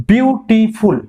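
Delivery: বিউটিফুল broken up to count three syllables. Shown as a demonstration. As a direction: say বিউটিফুল as beau-ti-ful, each part distinct. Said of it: "তিনটে syllable উচ্চারণ করছো".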